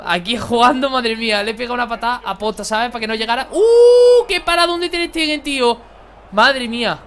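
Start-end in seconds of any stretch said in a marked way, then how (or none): 3.5-7.0 huge high yelp, much louder; amazed shout. Real, not acted. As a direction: scream in disbelief at the save. Keeper's huge save.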